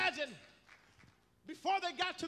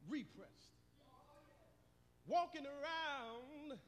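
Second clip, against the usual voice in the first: hushed sermon cadence; 5 dB quieter than usual. Acted.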